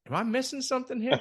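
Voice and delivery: high, disbelieving question; incredulous. Retold or imitated. To himself watching his frozen partner, high and incredulous. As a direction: wonder aloud if you missed something.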